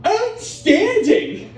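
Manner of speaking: high, stretched-out gushing exclamation; over-enthusiastic. Retold or imitated. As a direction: burst out far too enthusiastically. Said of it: "I usually get too enthusiastic".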